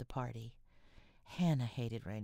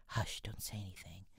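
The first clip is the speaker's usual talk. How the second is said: soft urgent whisper; whispered hush; 5 semitones lower, 4 dB quieter than usual. A narrator's reading. Meg to Jo, hiding her hurt foot, whispering. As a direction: hush her quietly, lower pitch. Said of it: "she whispered".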